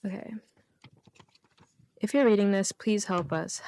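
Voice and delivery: usual speaking voice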